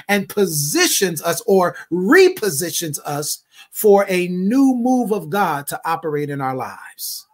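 climbing prophetic declaration; preaching at full force. Acted. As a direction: lift the congregation line by line. Prophetic word builds to preaching.

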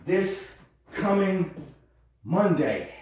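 slow, stretched This coming; drawn out. Shown as a demonstration. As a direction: draw out the words slowly. Repeats the phrase several ways.

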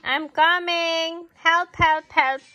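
high, loud, frantic cries for help; stuck truck calling out in alarm; crying for help. Acted. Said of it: "cried a little blue truck".

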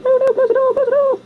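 put-on very high squeaky child voice; high mock panic; panicking kid. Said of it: "Yeah, the kid's like".

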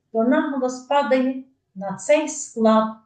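ordinary talk, nothing marked

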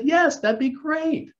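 a hint of a thrilled Penny accepting; eager Penny. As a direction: play Penny saying yes, pleased and drawn out. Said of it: "She probably would have said".

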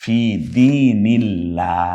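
slow recitation of দীনিল্লাহ with the lam kept light; thin lam. Shown as a demonstration. Teacher models the thin lam in দীনিল্লাহ for students. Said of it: "লামটাকে পাতলা করে বল".

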